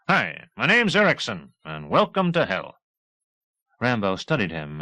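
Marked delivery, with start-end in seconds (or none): 0.1-2.8 cheerful grin in the voice; breezy welcome; grinning pilot. A narrator's reading.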